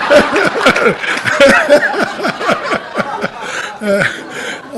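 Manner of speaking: long, high, helpless laughing fit; real laughter. Real, not acted. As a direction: crack up at your own story. Laughs at own punchline.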